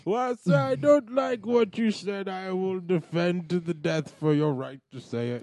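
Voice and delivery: slow, measured mixed-up politician impression; Obama mixture. Host answering his co-host formally, mixed Obama impression. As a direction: proclaim it slowly in character. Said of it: "you're doing Obama's".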